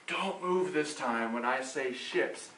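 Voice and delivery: low, soft, slow warning; hushed aside. Real, not acted. Leader coaching players not to fall for tricks, hushed. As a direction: drop right down, as if letting them in on it.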